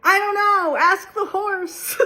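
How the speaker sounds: high, loud, helpless reply; comic panicked rider, slow on ask the horse; rider calling back. Retold or imitated. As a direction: toss it back over your shoulder while you're carried off. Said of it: "the guy on the horse goes".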